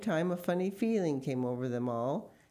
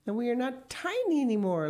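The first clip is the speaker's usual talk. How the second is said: higher-pitched happy exclamation, no actual laugh; lively, raised-pitch relief; laughed, joyful; 7 semitones higher, 3 dB louder than usual. A narrator's reading. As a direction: announce it with delight. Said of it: "laughed Missus Gregg".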